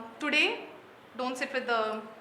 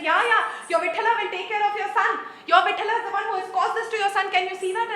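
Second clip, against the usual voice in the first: fast, higher, louder mocking retort with a laugh; sarcastic shouting; 6 semitones higher, 7 dB louder, faster than usual. Wife mocking her husband's god as she shouts. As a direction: jeer at his faith while scolding. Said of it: "as she was shouting".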